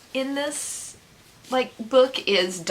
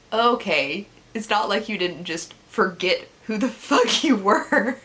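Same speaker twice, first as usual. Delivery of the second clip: sarcastic therapist; 4 semitones higher than usual. Acted. Acts out therapist's sarcastic reply.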